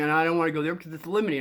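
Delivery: ordinary talk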